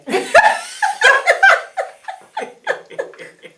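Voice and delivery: high burst of laughter; loud laugh. Real, not acted. Laughing at the story.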